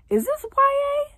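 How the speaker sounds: very high, slow disbelieving question; incredulous squeak. Real, not acted. Vlogger questioning the book's swearing, high squeak.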